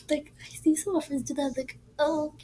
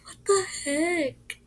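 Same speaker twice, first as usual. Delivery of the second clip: scared whisper; 5 semitones higher, slower than usual. Retold or imitated. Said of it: "I was like".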